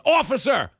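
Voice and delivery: very high-pitched cry for police; hammed-up yell, pitch way up; mock yelling. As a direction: holler for an officer like an aggrieved hustler. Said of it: "the guy starts yelling".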